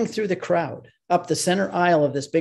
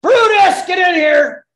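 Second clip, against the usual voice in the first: full-throated roar, much higher and louder; bellowing for the executioner; 11 dB louder, slower than usual. Acted. Governor summoning the executioner Brutus, roaring. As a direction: bellow the name across the hall like a Roman governor. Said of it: "And I turn around and I say".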